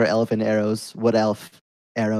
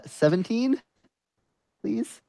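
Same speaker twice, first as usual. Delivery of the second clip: hopeful pleading; 11 semitones higher, 3 dB quieter, slower than usual. Real, not acted. Player pleading with game master.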